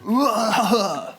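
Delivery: laid-back, high surfer drawl; surfer dude voice. Pretend. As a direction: play a chill beach surfer. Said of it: "I don't like your surfer voice".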